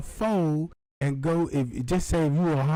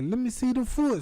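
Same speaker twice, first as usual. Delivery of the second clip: high demanding; 7 semitones higher than usual. Retold or imitated. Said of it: "they were like".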